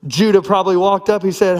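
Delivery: ordinary talk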